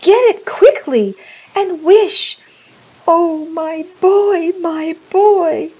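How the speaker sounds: high, loud desperate plea; frantic, panting. A narrator's reading. Mother begging for her dead son back, high frantic wail. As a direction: plead wildly, drawing out the grief. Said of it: "she panted".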